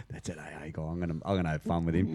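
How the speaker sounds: low, hushed scheming aside; little DJ inside speaker. Pretend. Little DJ inside the speaker plotting mischief, low and hushed. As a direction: mutter a plot under your breath, amused. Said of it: "the little D J that sits inside".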